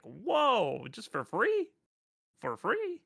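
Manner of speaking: high, incredulous mock astonishment; hammed-up astonished questioning; amazed disbelief. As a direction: let it leap up high like you can't believe the deal. Acting amazed reaction to free games.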